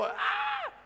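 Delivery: sudden high yelp of alarm; startled shout. Retold or imitated. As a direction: cry out in a sharp high burst. Startled cry opening story.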